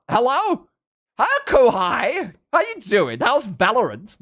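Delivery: high, loud, delighted welcome; excited greeting. Real, not acted. Streamer welcomes Kohai arriving with a raid, excited.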